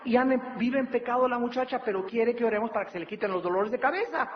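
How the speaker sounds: mild pastor reprimand; scolding pastor. Retold or imitated. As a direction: scold the mother firmly. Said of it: "le digo a su mamá".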